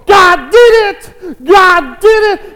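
very high, loud, drawn-out yell; acted overjoyed widow drowning out objections; jubilant shouting. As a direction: shout it slowly in triumph. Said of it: "And she's going".

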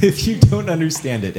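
laughing while repeating the joke. Real, not acted. Teacher repeats a student's poetry joke, laughing. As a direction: echo the answer through a real laugh.

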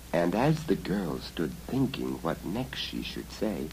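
ordinary delivery, nothing marked

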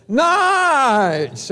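put-on high, stretched-out child's whoop; imitated boy's yell. Preacher greeting the congregation like his grandson, high drawn-out yell. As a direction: mimic a little boy yelling, held long and high. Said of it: "Sammy yelled out".

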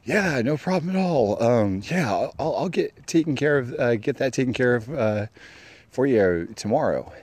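mock him as a high, overly agreeable pushover; meek guy's voice. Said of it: "He's like".

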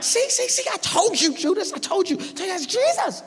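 very high, excited squeal; vindicated I-told-you-so in a squeaky voice; Peter. Acted. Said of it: "And Peter's like".

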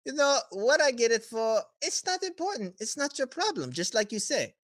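high, swooping villain voice; smooth-talking villain. Acted. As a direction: talk them down with airy, high-pitched reassurance. Villain negotiates with player.

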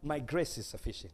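a quiet, settled answer from above; hushed voice of God. Acted. Preacher voicing God answering a begging believer, softly. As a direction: speak God's reply softly and calmly.

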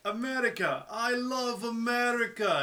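high, drawn-out mock Japanese accent; Kenzo Suzuki. Fan imitating a wrestler declaring love of America, hammed-up Japanese accent. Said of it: "or Kenzo Suzuki back in two thousand eight".

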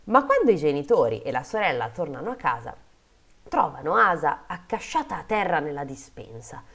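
animated fairy-tale reading; dramatic story narration. A narrator's reading. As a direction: tell the grim part like a storyteller. Reads a fairy tale aloud.

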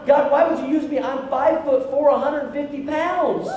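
high, pleading excuse; mock-exasperated self-pity; acted protest. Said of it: "he's like".

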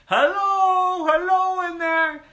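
very high, booming hello. Acted. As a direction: try again, hailing with full voice. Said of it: "And they tried again".

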